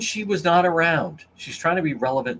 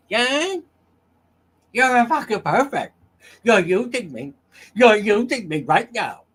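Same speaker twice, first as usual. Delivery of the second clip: high, loud mock drunk whine; imitating a slurring woman accusing someone; Karen voice; 6 semitones higher, 5 dB louder than usual. Host mocking Karen on his stream, high mock drunk whine. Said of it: "the Karen voice".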